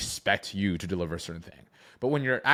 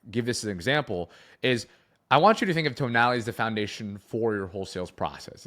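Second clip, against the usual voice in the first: ordinary talk, nothing marked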